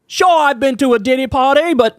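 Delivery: horrible mock British accent, pitched high. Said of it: "that's a horrible [name] accent".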